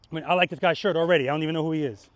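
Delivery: usual speaking voice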